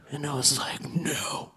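low, hushed edgelord delivery; edgelord comic. Acted. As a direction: softly refuse as an edgy comic. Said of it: "I will now demonstrate for you. The edgelord".

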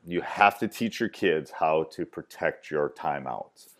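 normal talking voice

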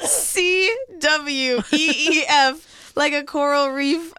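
spelled out slowly through laughter; crying with laughter; laughing while talking. Real, not acted.

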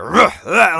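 two loud, angry effort grunts; imitated grunting. Podcaster imitating Vader tearing the ship apart, grunting. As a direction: grunt like someone straining in rage. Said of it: "He's, like, grunting".

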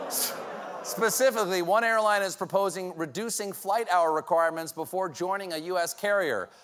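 normal talking voice

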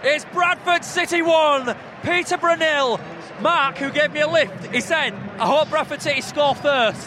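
loud, slow, high score announcement; excited commentary. Real, not acted. Commentator announces the opening goal, excited. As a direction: proclaim the score to the crowd.

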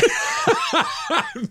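squealing burst of laughter; high shrieking laugh. Real, not acted. Laughing at the story.

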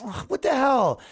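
high mock exasperation; dismayed. Protester exasperated by the wrecking, mock dismay. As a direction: sound fed up.

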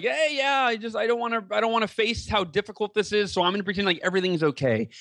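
doctor mimicking a patient who pretends all is fine; checked-out denial. Lightly mocking carefree patient voice. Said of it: "he's checked out and he's just like".

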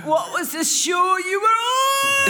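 very high, drawn-out yell; hammed-up wail from a desperate fan; mock wailing shout. Sick boy asking about her show, high wailing shout.

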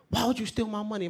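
high, heated yell of accusation; a mock furious grandfather; angry grandfather. Grandfather scolding the uncle over stolen money, heated.

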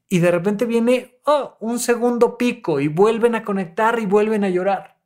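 ordinary delivery

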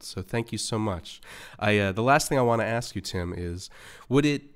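ordinary delivery

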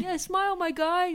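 high, hammed-up Bronx accent. Shown as a demonstration. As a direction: say it like a guy from the Bronx. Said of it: "like, the Bronx accent?".

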